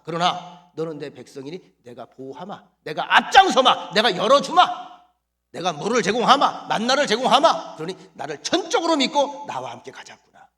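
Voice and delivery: loud, booming divine promise; God's voice. Acted. Preacher voicing God promising to lead Israel, booming. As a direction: speak as God reassuring his people.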